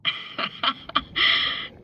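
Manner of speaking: short amused breathy laugh. Real, not acted. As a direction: laugh it off, teasing. Teasing while swimming together.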